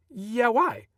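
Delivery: mildly puzzled, pitch lifted; puzzled reply. A narrator's reading. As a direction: answer as if caught off guard. Puzzled reply to parents, narrator acting.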